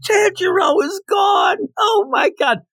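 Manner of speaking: hammed-up panicked yell; mock scream. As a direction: shriek it like a frantic messenger.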